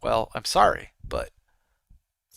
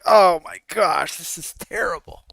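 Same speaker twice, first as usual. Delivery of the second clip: mock disgust; 3 semitones higher, 4 dB louder than usual. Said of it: "I'd walk in like".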